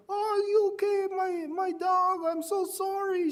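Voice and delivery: worried lady. Retold or imitated. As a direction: squeak it out like a flustered dog owner apologizing. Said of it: "she, she was saying".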